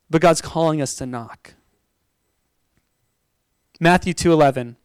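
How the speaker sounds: ordinary talk, nothing marked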